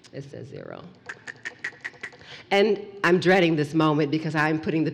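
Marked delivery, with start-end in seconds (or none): 0.1-0.8 very low and near silent; deeper hushed dread. Acted. Storyteller repeating the counter, lower and ominous.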